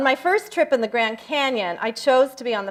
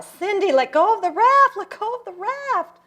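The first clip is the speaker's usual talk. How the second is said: high-pitched urgent call of a guide; re-enacted shout, much higher than usual; imitated yell; 10 semitones higher than usual. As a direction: mimic yelling over roaring rapids. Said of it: "I kept hearing Larry yell".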